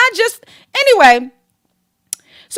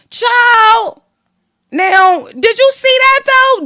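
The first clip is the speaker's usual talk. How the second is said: excited; 7 semitones higher, 3 dB louder, much slower than usual. Real, not acted. Reacting to the post.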